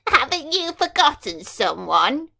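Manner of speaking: the mean fairy, uninvited, chilling the hall. Acted. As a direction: ring out an icy threat. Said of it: "a chilling voice rang out".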